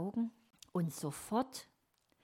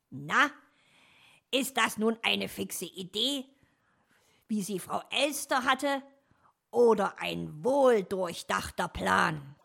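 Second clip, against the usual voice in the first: louder, pleased with own plan; expecting praise; 3 semitones higher, 7 dB louder than usual. A narrator's reading. Fox presents his plan to Dominik, proudly expectant. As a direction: show off the plan, fishing for praise. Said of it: "in freudiger Erwartung eines Lobs".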